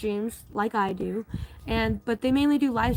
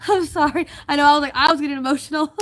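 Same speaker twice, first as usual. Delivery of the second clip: laughing through tears; 4 semitones higher, 7 dB louder, faster than usual. Real, not acted.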